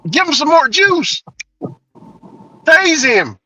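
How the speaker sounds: high urging officer; mock cop egging on; excited cop. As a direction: insist he tases again. Officer insisting on tasing.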